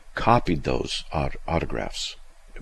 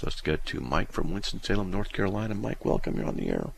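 ordinary talk

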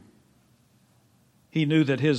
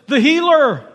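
excited news; 9 semitones higher, 6 dB louder than usual. Acted. Crowd member telling blind man.

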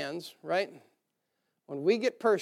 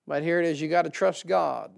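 normal talking voice

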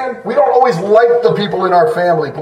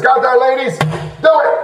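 higher, punchy jokey order; mock bossy command; 5 semitones higher than usual. Preacher jokingly ordering the ladies to submit, mock bossy. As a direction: lay down the law in jest.

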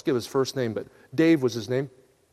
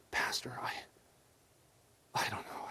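whispered, much softer, barely there; ashamed whisper; 7 semitones lower, 16 dB quieter than usual. Retold or imitated. Dave caught in the bar answering his pastor, whispered.

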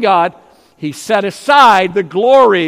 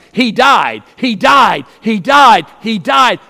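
louder, each "he died" pounded with a falling end; preaching shout; 3 semitones higher, 5 dB louder than usual. Acted. Preacher drives home to the congregation that he died, loud. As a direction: drive it home like a hammer blow. Preacher hammering repeated phrase.

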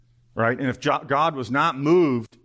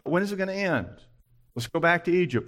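higher-pitched mock grumbling; impatient complaint; 6 semitones higher than usual. Israelites complaining to God mid-trial, mock impatient grumbling. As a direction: lightly mimic a whiny, impatient complainer.

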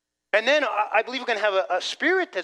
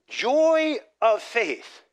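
JOY OF FAITH drawn out slowly and higher; emphatic key phrase; 5 semitones higher, 4 dB louder, much slower than usual. Real, not acted. Preacher reading verse to congregation, "joy of faith" slow and emphatic. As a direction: land hard on "joy of faith", stretching each word. Stressing sermon point within reading.

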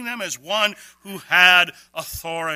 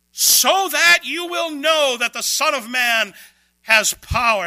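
raised, higher voice, slowly declaring power; forceful proclamation; 8 semitones higher, 8 dB louder than usual. Acted. Jesus to the scribes, a slow raised proclamation. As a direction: proclaim it like Jesus announcing his authority.

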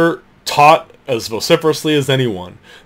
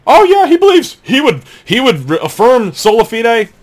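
mocking high voice; 7 semitones higher, 4 dB louder than usual. Mocking Schlissel's answer.

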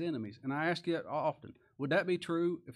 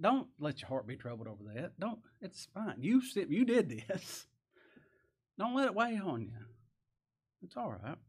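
gentle reassurance; 4 semitones higher than usual. Retold or imitated. Joseph comforting his weeping brothers.

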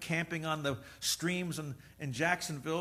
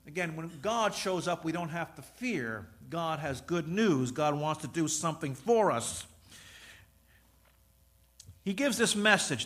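ordinary delivery

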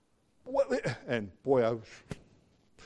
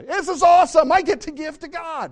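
exultant; 13 semitones higher, 7 dB louder than usual. Real, not acted. Preacher exulting over giving.

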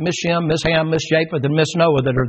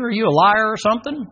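usual speaking voice

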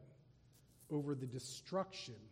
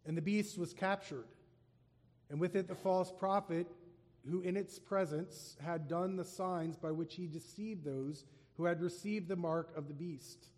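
raised, solemn reading voice; scripture reading; 3 semitones higher, 4 dB louder than usual. Acted. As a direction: proclaim the passage to the congregation. Reads scripture aloud.

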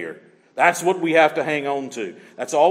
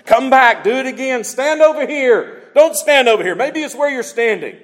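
higher, louder fussing orders; mock-frantic bossing around; hammed-up impatient Balak; upset king; 8 semitones higher, 4 dB louder than usual. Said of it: "Balak's upset again".

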